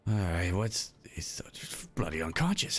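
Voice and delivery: shocked whisper. Acted. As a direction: whisper over the body. Cultist whispers over the body.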